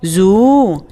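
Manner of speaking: زو said as zu with rounded u; letter sound demo. Shown as a demonstration. Teacher showing children the damma sound زو. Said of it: "مع الضمة".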